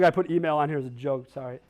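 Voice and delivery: ordinary talk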